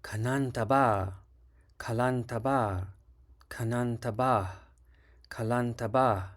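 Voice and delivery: Kanantaba with a high or falling final syllable; careful tone demo, final syllable high; last syllable high. Shown as a demonstration. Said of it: "The last syllable is high or descending".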